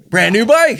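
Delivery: high, loud, triumphant cap to a list; excited. Real, not acted. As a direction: exclaim it with delight. Proudly caps list of rebuilt parts.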